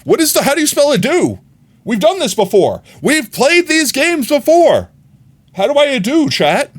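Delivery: loud, high exasperated outburst; frustrated yelling at the screen. Real, not acted. Frustrated, can't spell the word.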